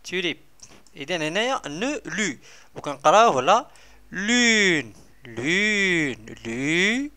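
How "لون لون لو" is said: لون stretched very slowly; teacher sounding out لون for pupils. Shown as a demonstration. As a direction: draw out لون syllable by syllable.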